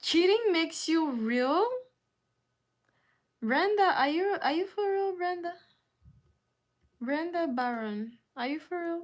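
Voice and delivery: high, loud disbelief; can't believe what she just wrote; incredulous. Real, not acted. Streamer calling out a viewer in loud high disbelief.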